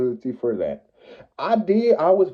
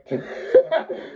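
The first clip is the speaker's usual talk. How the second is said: a real airy laugh, pitched up; can't help laughing at it; high wheezy giggle; breathy laugh; 13 semitones higher than usual. Real, not acted. Laughs at ex being mad.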